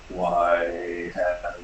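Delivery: low rumbling voice from the stone, hushed; echoing creature voice, breathy at the end; deep rumbling voice. Acted. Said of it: "You hear a deep rumbling voice".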